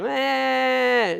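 high, drawn-out mock toddler wail; imitated child's whine. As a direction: whine wordlessly like a three-year-old. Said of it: "So she's just whining".

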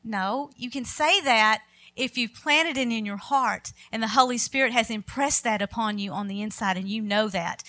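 usual speaking voice

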